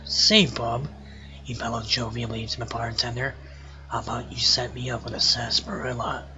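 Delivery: low, hushed campfire storyteller; spooky story narration. Acted. Reading a cowpoke ghost story aloud in a low, hushed voice. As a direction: read it low and soft, building suspense.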